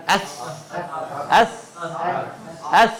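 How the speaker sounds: اس with breath flowing out on the s; airy s. Shown as a demonstration. Teacher showing air escaping in the sound. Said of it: "ہوا باہر جائے گی اس میں".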